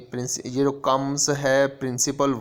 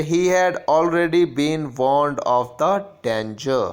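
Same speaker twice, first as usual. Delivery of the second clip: slow, deliberate reading of the example sentence; 3 semitones higher, 5 dB louder, much slower than usual. Shown as a demonstration. Teacher reading an example sentence to the class, slow and clear. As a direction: read it out slowly like a teacher at the board.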